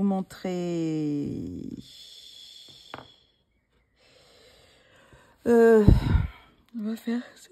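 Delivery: talking through a yawn; stretched yawning words. Real, not acted. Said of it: "Je baille".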